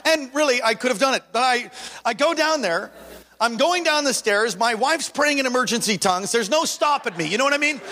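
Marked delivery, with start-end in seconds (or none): none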